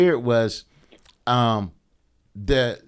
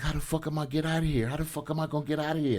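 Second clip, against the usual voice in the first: panicked inner thought; 2 semitones higher, 8 dB quieter, faster than usual. Retold or imitated. Racing heart, panicked inner thought.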